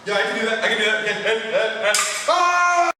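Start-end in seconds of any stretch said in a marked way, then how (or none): 1.9-2.9 sudden high yelp; startled yelp. Acted. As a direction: yelp in alarm. Startled by staple.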